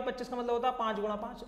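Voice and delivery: ordinary talk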